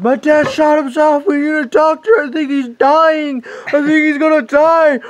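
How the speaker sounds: very high, frantic imitation of a panicked boy; freaking out. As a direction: plead for a doctor at the top of the voice. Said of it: "he starts freaking out".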